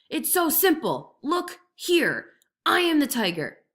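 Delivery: slow, loud over-explaining; impatient tiger. A narrator's reading. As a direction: spell it out to a fool. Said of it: "roared the tiger".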